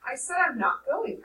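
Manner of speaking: put-on boy repeating his refusal; firm repeat. A narrator's reading. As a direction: dig in on the refusal. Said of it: "I repeated".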